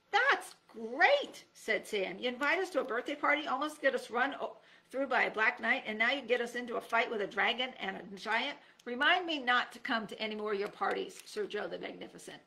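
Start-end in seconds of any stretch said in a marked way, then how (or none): 0.1-1.4 high mock-delighted squeal; sarcastic. Sam's sarcastic reply. 2.2-12.4 higher, faster exasperated complaint; complaining. Acted. Sam complaining to Joe.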